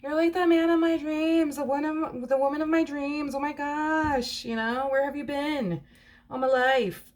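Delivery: gushing new partner, over the moon; gushing lovestruck lover. Retold or imitated. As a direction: play the starry-eyed partner who can't believe their luck. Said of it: "this feminine energy feels like".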